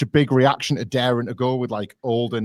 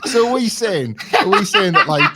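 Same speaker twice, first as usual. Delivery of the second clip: high-pitched, laughing interjection; talking through rising laughter; 9 semitones higher than usual. Real, not acted. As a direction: cut in while cracking up. Laughing at co-host's comparison.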